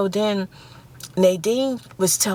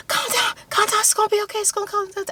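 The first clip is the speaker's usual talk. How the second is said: much higher, frantic reassurance; the guy on the boat pleading with her to calm down, high and frantic; panicked guy; 13 semitones higher, faster than usual. Retold or imitated. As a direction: squeak it out like a man trying to calm himself. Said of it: "the guy was like".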